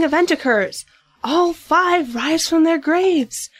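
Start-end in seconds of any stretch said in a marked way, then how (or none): 1.2-3.5 slow, higher-pitched spooky storytelling; dramatic narration. A narrator's reading. Narrator reveals the dead rising, dramatically. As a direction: draw it out for suspense.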